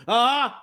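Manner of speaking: loud, high mock "ah"; dismissive blurt. Tired actor not wanting to be bothered, loud "ah". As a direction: answer and end the chat in one blurt. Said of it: "he was nice, but".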